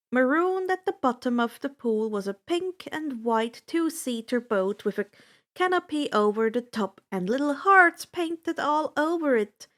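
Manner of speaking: ordinary delivery, nothing marked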